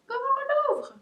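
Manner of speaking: very high, loud playful squeal; squeaky repeated question. Real, not acted. Puzzling over the treat stick, high squeaky question.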